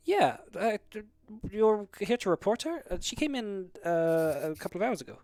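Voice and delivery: lightly voiced clerk.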